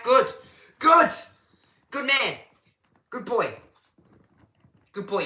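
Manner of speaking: high, loud squeals of "good"; excited praise. Real, not acted. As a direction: cheer him like a proud coach. Delighted viewer not at Lake Mac.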